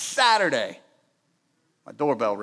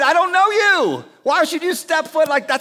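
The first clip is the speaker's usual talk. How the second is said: indignant door reply; 7 semitones higher, 5 dB louder than usual. Retold or imitated. Acting out answering the stranger.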